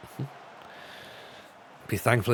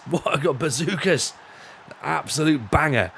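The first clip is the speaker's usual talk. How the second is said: higher, bouncy impression of a promoter's voice; Toby Foster; 4 semitones higher than usual. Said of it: "he talks like this, right? And that's genuinely is his voice".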